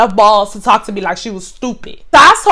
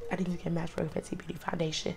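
very soft, fast, barely voiced murmur; mock mumble; 7 semitones lower, 24 dB quieter, faster than usual. Imitating the customer's mumbled repeat, very soft and fast. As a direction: rush it out in a near-inaudible mumble. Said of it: "I said".

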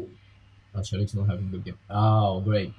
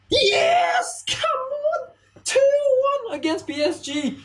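high screaming cheer; goal celebration shout; slower than usual. Real, not acted. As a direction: yell with delight.

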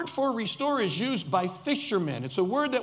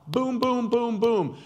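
mock gunfire booms; 4 dB louder, slower than usual. Mimicking rapid gunfire bullet points.

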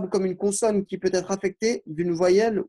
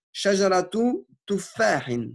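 slow, deliberate dictation of Arabic words; careful teacher dictation with pauses between words; 3 semitones higher, slower than usual. Shown as a demonstration. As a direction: dictate each word slowly so students can write it. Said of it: "vous verrez".

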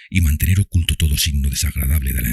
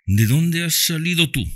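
brusque question; 14 semitones higher than usual. A narrator's reading. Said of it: "preguntó bruscamente".

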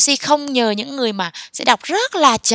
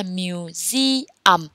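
"museum" drawn out, stressed on the middle "se"; stress on second syllable; 4 semitones lower than usual. Shown as a demonstration. Teacher showing learners how to stress "museum", mu-SE-um. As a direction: slowly say "museum", leaning on "SE". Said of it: "nhấn ở âm hai".

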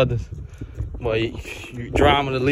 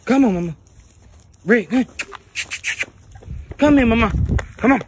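calling the dog; 9 semitones higher, faster than usual. Real, not acted.

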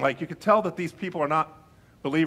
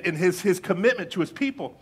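ordinary talk

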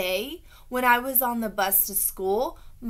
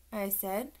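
usual speaking voice

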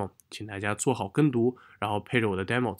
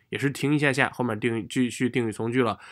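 ordinary talk

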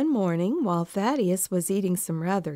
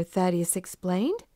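usual speaking voice

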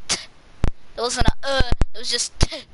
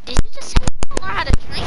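incredulous shout; 8 semitones higher, 9 dB louder, much faster than usual. Real, not acted.

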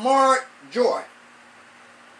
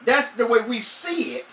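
rising, louder preacher's cadence; preaching; 3 semitones higher, 4 dB louder than usual. Acted. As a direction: drive the verse home to the congregation.

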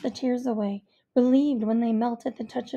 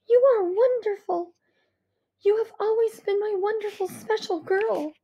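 higher, tender reassurance; tender mother; 9 semitones higher, 4 dB louder than usual. A narrator's reading. Mother comforting her crying daughter, tender. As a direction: wrap her daughter in praise. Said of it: "mother reassures crying daughter".

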